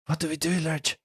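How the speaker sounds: high pleading voice. Acted. GM voices Saloni.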